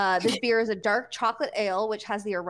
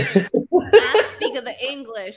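mock English accent; 11 dB louder than usual. Co-host teases Brit about Queen's English, mock English accent. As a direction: ham up the English, half laughing.

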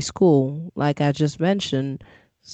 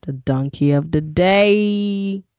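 slow, drawn-out, raised announcer call; hammed-up announcer, higher and drawn out; mock award announcement; 3 semitones higher than usual. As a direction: stretch it out like a show segment title.

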